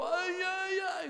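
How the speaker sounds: high, loud hammed-up cries of pain; mock wailing man. Lecturer contrasts the bigger man's dramatic pain, loud mock wails. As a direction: overact the big man's suffering. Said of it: "Más grande, más musculoso, peor".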